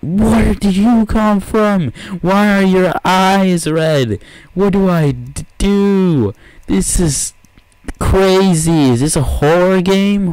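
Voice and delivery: high, loud, slow frightened shrieks; scared shriek. Real, not acted. Gamer shrieking at red-eyed dogs in the dark. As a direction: shriek like in a horror game.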